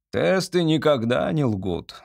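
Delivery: plain reading voice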